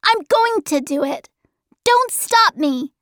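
put-on high, quick child's voice; defiant boy. Charles Wallace refusing to be stopped, high defiant boy. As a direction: insist fast and high, resolved. Boy defies his companions.

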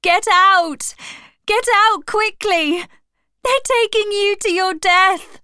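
high, loud, urgent warning; frantic shout. A narrator's reading. Clover warns Boxer to escape the lorry, frantic shout. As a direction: scream a warning to save him. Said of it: "The animals were all shouting now".

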